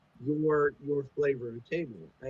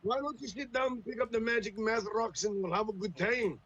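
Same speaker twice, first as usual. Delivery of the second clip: higher, hammed-up tabletop player greeting; mock D&D player voice; 4 semitones higher than usual. Mocking a hardcore player welcoming a newcomer, hammed-up. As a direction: ham it up like an overeager role-player. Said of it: "you basically walked in and went".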